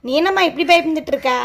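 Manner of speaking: ordinary talk, nothing marked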